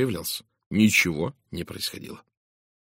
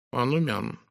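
musing, with slightly raised pitch; thoughtful; 4 semitones higher than usual. A narrator's reading. Belgian detective considers the doctor, thoughtfully. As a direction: turn it over as you speak. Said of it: "задумчиво заметил бельгиец".

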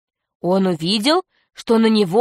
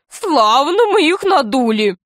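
high, gleeful pirate voice; Весельчак У; 6 semitones higher than usual. A narrator's reading. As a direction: crow over the trick in a high comic voice. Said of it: "И он расхохотался". (ликующе, высоким комическим голосом)

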